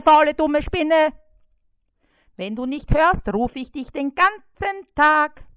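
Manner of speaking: high, loud, whiny fly yelling insults; nagging fly. A narrator's reading. Trapped fly Bissi nags and insults the spider, high and loud. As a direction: pester the spider without pause.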